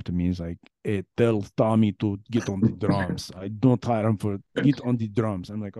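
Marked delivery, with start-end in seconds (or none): 0.8-5.5 put-on Eastern European accent. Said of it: "this Eastern European guy ... is like".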